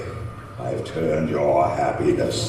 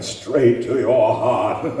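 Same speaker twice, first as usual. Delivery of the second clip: higher, mocking laugh at the end; gloating laugh; 8 semitones higher, 4 dB louder than usual. Monster gloating at Frankenstein, mocking laugh. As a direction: savour the revenge and laugh.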